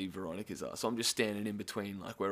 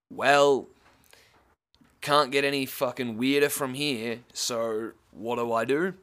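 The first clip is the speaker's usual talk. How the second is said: mock resignation; 3 semitones higher, 9 dB louder than usual. His exaggerated inner thought.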